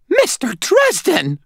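very high, squeaky outraged cry; squeaky old lady. A narrator's reading. Startled old lady to the intruder in her bedroom, squeaky. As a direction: squeak it out slowly, in full indignation. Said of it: "her voice squeaky".

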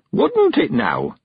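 put-on high, slow old man's retort; old Mister Weevil teasing the girl, high and slow. A narrator's reading. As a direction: pitch up and drag out an old man's sly question. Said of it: "said Mister Weevil".

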